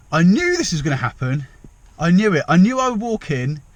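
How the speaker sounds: half-laughing frustration; worked-up exclamation. Real, not acted. Recounting the awkward entrance.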